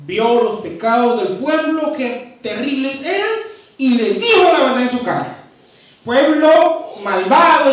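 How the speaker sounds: loud, driving sermon delivery; fiery preaching. Acted. Preacher thundering at a sinful people, loud and driving. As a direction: preach it hard to the congregation. Preacher building to full force.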